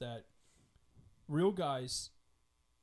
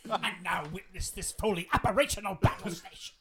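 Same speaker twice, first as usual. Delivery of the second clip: Emperor Palpatine voice; 2 semitones higher than usual. Retold or imitated. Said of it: "imitating Emperor Palpatine".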